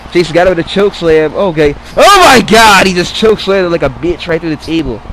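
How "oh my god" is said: shocked outburst. Real, not acted. Shocked at choke slam.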